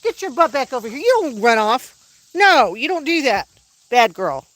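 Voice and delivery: loud, high scold; scolding the dog. Real, not acted. Owner scolds dog for running off, loud scolding. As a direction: tell her off firmly.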